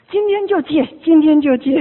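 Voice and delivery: loud, high, acted resolve; punchy acted declaration; emphatic vow. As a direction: blurt out a firm promise, imitating an eager convert. Acts convert vowing to quit smoking.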